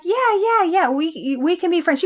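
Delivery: high-pitched, hurried-along reassurance; placating agreement. Retold or imitated. Garrity indulging the kid who wants friends, placating. Movie Garrity humoring a needy boy.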